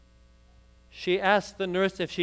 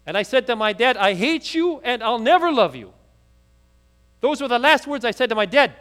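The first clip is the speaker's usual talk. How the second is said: pleading, building to loud anguish; a daughter pushing out her regret, louder and louder; the voice swells into a cry of guilt; distraught daughter; 3 semitones higher, 7 dB louder than usual. Acted.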